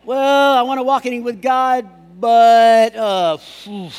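excuse-making. Acted. Preacher mimicking people with excuses, hedging. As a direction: hedge loudly, ending in a puff.

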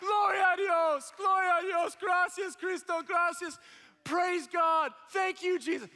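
high, loud mimicked cries of praise; crying out. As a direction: imitate an overcome man crying out thanks. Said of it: "starts crying, going".

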